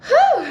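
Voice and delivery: loud, high "whew"; loud exhale. Real, not acted. Speaker gearing up to tell stories, loud whew. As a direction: let out a big high whew before starting. Winding up before telling stories.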